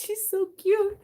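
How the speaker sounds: high, loud gush over a cute pet; high excited squeal about the cat. Real, not acted. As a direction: squeal it out fondly. Gushing over cat, much higher.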